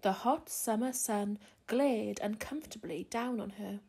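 plain reading voice

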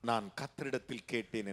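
low, soft repeat of the verse; quiet restatement. Real, not acted. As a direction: drop right down and say it simply. Same verse repeated after the shout.

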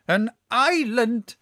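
high, eager cry of discovery; excited cry. A narrator's reading. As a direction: cry out as if spotting land. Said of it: "cries my uncle".

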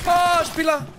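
sudden high alarmed yell; startled shout. Real, not acted. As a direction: blurt out the warning. Spots enemy player suddenly.

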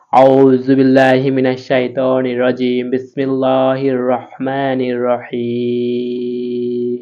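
drawn-out Quranic recitation; slow recitation. Shown as a demonstration. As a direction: recite slowly, each syllable stretched. (ধীর তিলাওয়াত)

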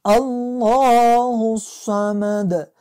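الله الصمد with a heavy, full lam; chanted الله الصمد; full Allah. Shown as a demonstration. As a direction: recite slowly and loud, الله heavy. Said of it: "باید از الله را پر بخونیم".

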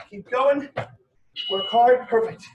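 loud encouraging workout calls; barking gym coach. Real, not acted. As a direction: hype them up, loud. Coach urging end of set.

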